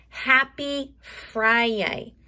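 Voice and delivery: "Happy Friday" stretched slowly; teacher repeating the principal's greeting slowly; slow sounded-out greeting. Shown as a demonstration. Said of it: "Mister Cullen is always saying".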